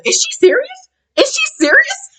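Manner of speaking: very high squeaky disbelief; hammed-up high-pitched incredulous repeat; mock incredulity. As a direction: squeal the question in disbelief, imitating someone. Acting out Jen's incredulous question.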